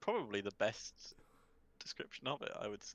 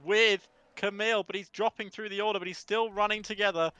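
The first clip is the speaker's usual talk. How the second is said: lively play-by-play race call; race commentary; 7 semitones higher, 6 dB louder than usual. Acted. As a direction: call the race live, building excitement.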